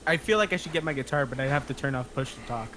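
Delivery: ordinary delivery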